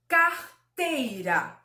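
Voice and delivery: "carteira" slowly, split after car; very clear. Shown as a demonstration. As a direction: sound out "carteira" for counting syllables. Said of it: "falei de forma muito clara". ("carteira" bem clara, devagar, por sílabas)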